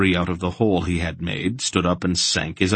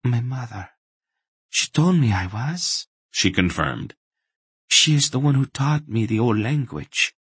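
put-on high, halting woman's voice with an accent; higher, fragile captive's confirmation; weary captive woman; 5 semitones higher than usual. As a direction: answer haltingly in a raised pitch.

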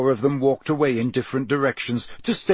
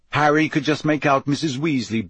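ordinary delivery, nothing marked

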